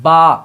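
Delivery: با said loudly, lips pressed then released; letter sound demo. Shown as a demonstration. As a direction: pop با out from both lips. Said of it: "تب بنتا ہے". (دونوں ہونٹ ملا کر زور سے با)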